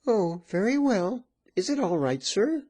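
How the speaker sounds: high landlady voice. Acted. Landlady asks him.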